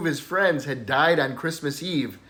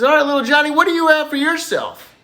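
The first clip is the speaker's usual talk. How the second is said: much higher, louder mock Saint Peter; a high hammed-up Saint Peter quizzing the boy; 11 semitones higher, 4 dB louder than usual. Saint Peter addresses Johnny.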